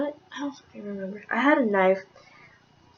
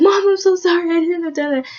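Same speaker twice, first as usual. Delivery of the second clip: pleading apology; 8 semitones higher, 5 dB louder than usual. Retold or imitated. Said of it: "like, a calm voice".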